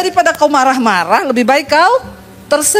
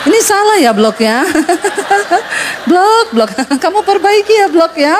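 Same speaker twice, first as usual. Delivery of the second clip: higher, laughing gentle scolding; smiling scolding; 4 semitones higher than usual. Retold or imitated. Boss scolding the clerk kindly while laughing, higher. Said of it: "Dia tersenyum".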